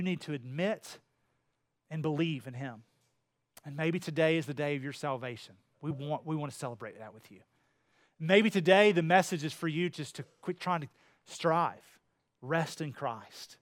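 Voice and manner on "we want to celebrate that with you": ordinary talk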